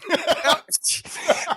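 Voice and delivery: high, airy laugh at the joke; a real, sudden laugh; burst of laughter. Real, not acted. Host laughs at twelve years answer.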